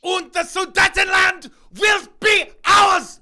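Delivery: put-on high, loud, slow German-accented ranting; mock German rally shout. Joking that the politicians sound like Nazis, hammed-up German shout. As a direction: roar it like a hammed-up German dictator.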